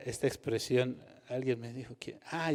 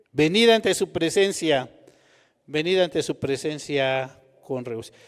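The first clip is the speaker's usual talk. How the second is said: louder reading; 10 dB louder than usual. Shown as a demonstration. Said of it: "ahí está más fuerte porque yo hablo".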